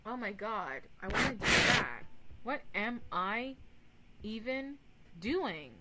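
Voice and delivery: ordinary talk, nothing marked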